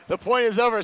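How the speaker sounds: playful imitation of fans shouting; mock crowd shout. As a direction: shout it like a rowdy Aussie fan. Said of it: "they came in here shouting".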